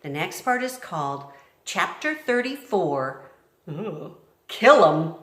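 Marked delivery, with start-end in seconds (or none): 4.5-5.1 loud. A narrator's reading. Chapter title read.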